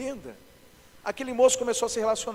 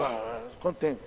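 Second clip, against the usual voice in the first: much lower, softer cavernous pastor voice; mock-solemn cavern voice; cavernous voice of authority; 6 semitones lower, 5 dB quieter than usual. Shown as a demonstration. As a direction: drop deep into a put-on voice of God-given authority. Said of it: "quem tá com autoridade de Deus já muda até a voz".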